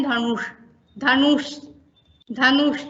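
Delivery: धनुष ending in a curled retroflex ष; retroflex sh word. Shown as a demonstration. Teacher drilling धनुष with trainees. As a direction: repeat धनुष, curling the tongue for ष. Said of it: "एक शब्द का उच्चारण करते हैं".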